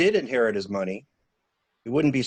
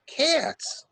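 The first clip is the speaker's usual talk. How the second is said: high, disbelieving echo; incredulous; 13 semitones higher than usual. Acted. Visitor hearing the husband shot cats, incredulous. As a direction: shoot the word up in disbelief.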